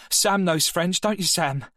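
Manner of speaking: mock the class like a smug fifteen-year-old; cheeky schoolboy.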